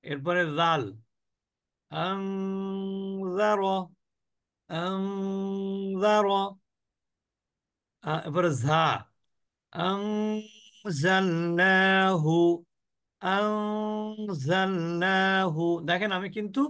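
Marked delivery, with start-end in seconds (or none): 1.9-6.3 আং held as a nasal hum before জার; ghunnah demo. Shown as a demonstration. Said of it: "অনিশ্বারের মতন উচ্চারণ করছি". 9.7-15.6 আং held in the nose, then জালনাহু slowly intoned; ghunnah demo. Shown as a demonstration. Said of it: "অনিশ্বারের মতন উচ্চারণ করছি".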